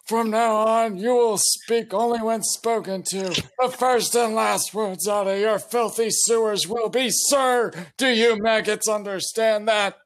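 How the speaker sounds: high barking drill sergeant. Acted. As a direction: bark orders like a drill instructor. Drill sergeant addresses recruits.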